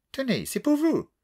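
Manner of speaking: put-on voix douce et aiguë, accent anglais caricatural; vieille Anglaise distinguée. A narrator's reading. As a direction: une mamie britannique charmée qui réclame la suite. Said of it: "voix douce et distinguée". (vieille dame anglaise au ton doux et distingué)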